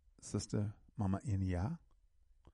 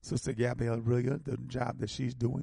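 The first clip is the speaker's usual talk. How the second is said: usual speaking voice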